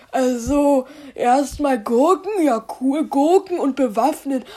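normal talking voice

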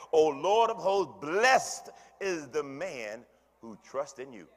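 loud, slow, fervent reading; scripture preached. Real, not acted. Preacher reading Psalm eighty-four to the church, loud and slow. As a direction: proclaim each phrase to the back row.

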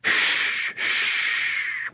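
soft, drawn-out hushing; soothing shush. A narrator's reading. Friend calms a panicking girl, soothing. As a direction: hush as if calming a frightened child. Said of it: "stroked Rosie's hair back".

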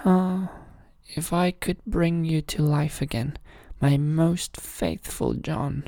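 mild tearful lament; weeping king. A narrator's reading. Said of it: "he wept and said".

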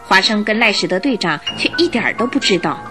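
ordinary talk, nothing marked

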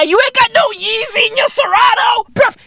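shrieky, incredulous high voice; hammed-up high-pitched ribbing; high-pitched mock outrage. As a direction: squeal it in comic disbelief. Mocking rant at SBF's outfit.

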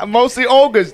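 high, loud, slow mock echo; mimicking echo. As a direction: parrot the phrase back, drawn out and high. Echoing friend's line in mimicry.

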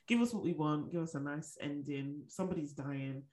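ordinary talk, nothing marked

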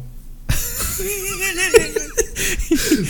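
squealing high laugh. Real, not acted. Laughing at toilet story.

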